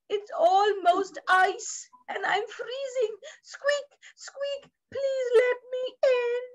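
high little mouse pleading; squeaky mouse. Acted. Mouse shows its icy tail to everybody, squeaky pleading. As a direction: squeak and beg like a tiny freezing animal. Mouse begs to come inside.

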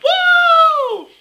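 loud, very high whoop; mock-triumphant "woo!"; celebratory woo. Real, not acted. Celebrates her first hate comment.

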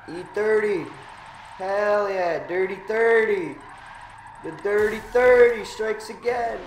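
loud, high victory gloat. Real, not acted. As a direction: crow over the win. Won the race, gloating.

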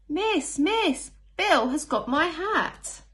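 put-on high, loud, slow tattling child voice; telling tales. Teacher reading a picture book, voicing a tattling child. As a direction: whine it to the teacher like a telltale.

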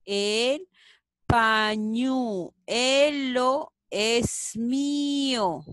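very slow, normal volume; slow rereading. Shown as a demonstration. Teacher rereads the sentence slowly at normal volume. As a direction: repeat it slowly. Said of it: "¿Cuál será?".

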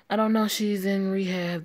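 a hint of distress breaking out; upset outburst. Upset answer about Joanne.

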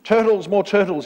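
high, loud braying call; bellowing. A narrator's reading. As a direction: bellow the order down to your subjects. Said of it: "he bellowed and brayed".

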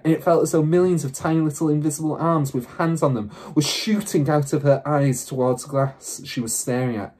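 plain reading voice

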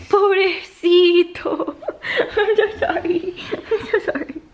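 put-on high, babying sympathy through laughter; mock pitying coo. Prankster pities the pranked partner, high teasing coo. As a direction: coo high and loud, laughing as you apologize.

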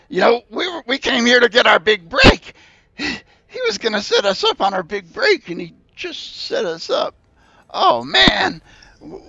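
high, loud tearful begging; squeaky creature crying and pleading. Acted. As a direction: beg them for help, near tears.

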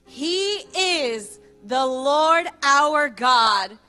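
ordinary talk, nothing marked

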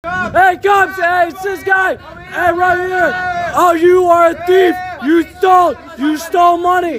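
real shouting at a thief in the street; shouting for the cops. Real, not acted. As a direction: get the police's attention, pointing him out.

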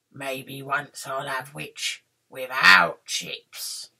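low, slow dragon voice; gloating dragon. Acted. Dragon licks lips over witch, low gloating. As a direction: draw it out low, savouring the meal.

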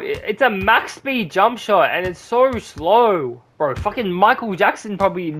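normal talking voice

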